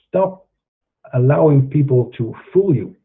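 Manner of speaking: normal talking voice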